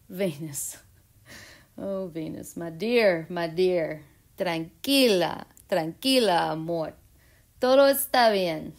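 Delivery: calm the jealous planet like a pet; soothing Venus. Pretend.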